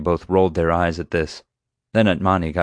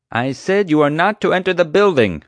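loud, high, emphatic command; forceful repeated order; 11 semitones higher, 6 dB louder than usual. A narrator's reading. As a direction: raise your voice over the racket. Chief repeats order over noise.

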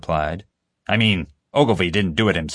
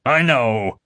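raised, urgent cry; exclaiming; 6 semitones higher, 4 dB louder than usual. A narrator's reading. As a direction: blurt it out in distress. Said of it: "James exclaimed".

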